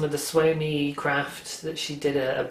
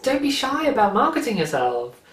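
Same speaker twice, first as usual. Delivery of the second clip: excited self-talk; 10 semitones higher than usual. Retold or imitated. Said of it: "I just really was like".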